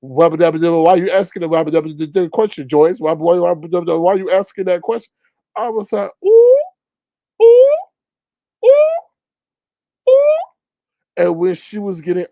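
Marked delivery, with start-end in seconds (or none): 0.0-5.1 rapid sputtering nonsense-filled babble; Daffy Duck. Retold or imitated. Panelist scolds Joyce for asking, babbling cartoon impression. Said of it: "in the Daffy Duck voice". 6.2-10.4 short falsetto oohs, spaced out; high "ooh". Retold or imitated. Host reacts to Joyce being scolded, high oohs.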